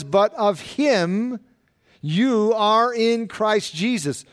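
"But of him" drawn out slowly, "him" rising; slow, stressed verse reading. Shown as a demonstration. Preacher to congregation, slowly stressing "But of him". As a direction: lean slowly on "But of him you are in Christ Jesus". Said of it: "Notice how this verse begins".

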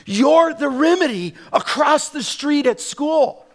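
loud, high, fervent preaching; preaching at full force. Acted. Pastor urging congregation they are the remedy. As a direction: build the sermon to a peak.

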